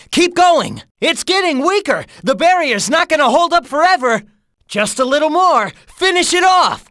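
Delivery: high, pressing battle cheer; excited urging. Acted. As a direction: egg a teammate on to the finish. Urging on the final attack.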